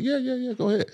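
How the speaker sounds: casual go-ahead. Retold or imitated. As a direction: wave it off, clueless and easygoing. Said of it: "and I'm like".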